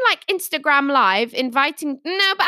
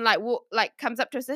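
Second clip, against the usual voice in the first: ordinary delivery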